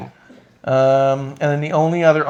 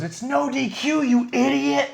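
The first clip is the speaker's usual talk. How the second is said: imitated angry yelling; 13 semitones higher, slower than usual. Jericho snaps at the counting ref, imitated high yell. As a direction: mimic a wrestler scolding the ref, pitched way up. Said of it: "he keeps yelling at him".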